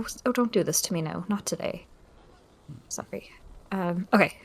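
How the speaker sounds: normal talking voice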